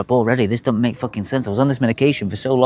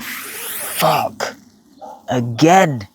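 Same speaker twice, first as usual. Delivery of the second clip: breathy, whispered fuck; whispered dismay at seeing blood; despairing curse; 3 semitones higher, much slower than usual. Retold or imitated. Whispered despair on the toilet at the blood. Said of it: "I thought".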